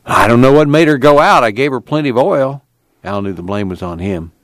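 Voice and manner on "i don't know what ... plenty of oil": higher, louder self-justifying protest; defensive explaining. A narrator's reading. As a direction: explain as if being blamed. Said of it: "Al explained".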